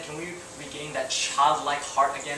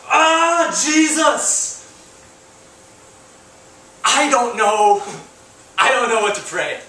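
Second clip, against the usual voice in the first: much higher, louder anguished cry to Jesus; retold weeping cry; 7 semitones higher, 8 dB louder, slower than usual. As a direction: cry out helplessly, at a loss for words. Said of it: "I was crying".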